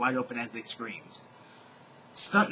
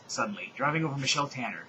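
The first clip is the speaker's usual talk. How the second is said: ordinary delivery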